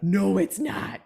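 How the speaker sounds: mock stubborn refusal. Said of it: "my body's like".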